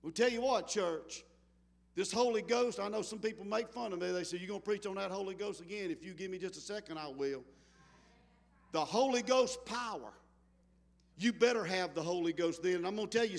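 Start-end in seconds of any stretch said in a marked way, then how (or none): 4.4-7.4 quick aside. Retold or imitated. Quick aside voicing critics and reply.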